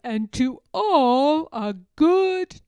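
slow, loud, booming goodbye; exclaiming farewell. A narrator's reading. Saint Nick calling goodnight from his sleigh, slow loud exclaim. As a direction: boom it out slowly as he drives off. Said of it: "But I heard him exclaim".

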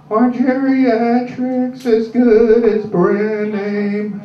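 put-on very slow, high old woman saying "geriatrics" for generics; confused old lady. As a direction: drag out "geriatrics" like a muddled elderly caller. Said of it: "Do you mean are generics".

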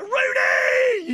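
high, long drawn-out yell of the name; playground shout. Retold or imitated. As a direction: shout it as you kick the ball. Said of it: "what name do you shout".

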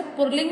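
"पुल्लिंग" said "pur-ling", r before l; wrong pronunciation. Shown as a demonstration. Teacher reading the wrong word, mispronounced. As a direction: put an r in "पुल्लिंग". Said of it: "नहीं बोलते बच्चों".

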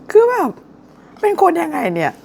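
very high-pitched squeal, sliding down; amused disbelief. Real, not acted. Vlogger reacting to a mean comment about her, high-pitched incredulous squeal.

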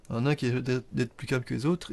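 usual speaking voice

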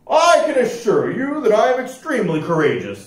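high, prim and proper voice; un-piratelike rascal. A narrator's reading. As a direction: explain it very properly, pitched high. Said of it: "said the rascal".

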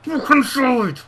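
high, loud angry yell at the screen; furious shout. Real, not acted. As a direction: blow up at the misplaced pass. Furious at a misplaced pass.

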